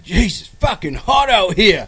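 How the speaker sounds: hammed-up friend griping that it's too hot; friend complaining of heat. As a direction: moan about the heat like a spoiled local. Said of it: "he says to me".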